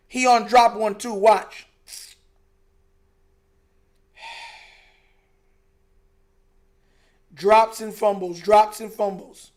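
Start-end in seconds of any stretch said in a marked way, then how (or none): 4.1-8.4 drawn-out sigh, dragging out drops with a rise; exasperated sigh that lets the word hang; weary, disgusted lament. Real, not acted. Sighing at the team's mistakes. 8.4-9.5 slow, spelled-out repeat, every word weighed; slow, pointed restatement of the complaint; slow and deliberate. Real, not acted.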